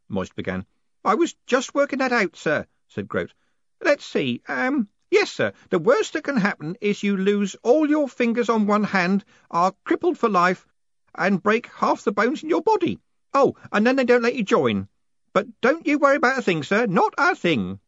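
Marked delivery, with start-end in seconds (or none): 1.0-2.7 put-on high, loud old underling; fussy elderly postman; Groat, obliging old postman. A narrator's reading. 3.8-17.8 put-on high, loud, cheerful old underling; fussy old postman, blithely reassuring; Groat, reassuring old postman. A narrator's reading.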